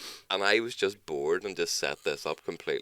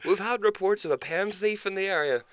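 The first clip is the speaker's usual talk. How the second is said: much higher fake police voice; mock officer; 8 semitones higher than usual. Prank cop asks the takeaway about a pen thief, much higher. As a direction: play the cop, pitched well up. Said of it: "It's like".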